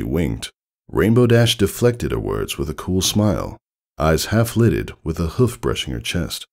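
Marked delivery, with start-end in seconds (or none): none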